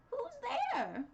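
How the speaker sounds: put-on high, loud old woman calling; grandmother's call. A narrator's reading. Grandmother calling from bed, high and loud. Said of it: "called the grandmother".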